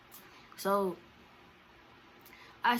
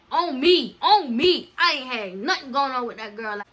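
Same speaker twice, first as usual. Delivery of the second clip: loud, higher, insistent oath; real, emphatic denial at raised volume; emphatic swearing; 5 semitones higher, 11 dB louder than usual. Real, not acted. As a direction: punch it out to be believed. Swearing she is telling truth.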